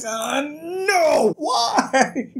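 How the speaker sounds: high anguished wail through laughter; laugh-cry a dramatic high oh no why; laughing despair. Retold or imitated. Vlogger reacting to Kvothe's confession, laughing high wail. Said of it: "it's just like".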